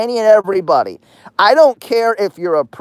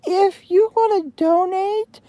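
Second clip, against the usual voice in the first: high, soft put-on voice for a mocking quote; mocking Jeremy; 10 semitones higher, 4 dB quieter than usual. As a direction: raise the pitch and softly mimic his plea. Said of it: "He, he says, and I quote".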